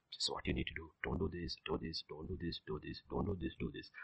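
very soft, monotonous list of instructions; quiet inner voice. Acted. God's ignored voice repeating what to do, hushed murmur. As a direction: murmur it like a voice being tuned out.

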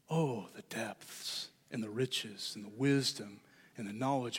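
low, soft, slow reverent reading; hushed awe. Acted. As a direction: recite it quietly, marvelling. Reads Paul's awed praise aloud.

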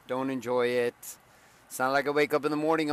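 ordinary delivery

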